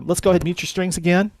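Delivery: ordinary delivery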